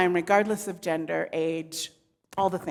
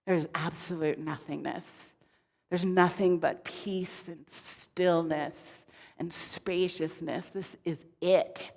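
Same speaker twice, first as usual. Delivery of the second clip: soft, low, serene murmur; hushed, low, blissed-out bliss; blissed-out zen god; 5 semitones lower, 9 dB quieter than usual. Acted. Shiva savouring the stillness, blissed-out. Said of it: "blissed out in his zen".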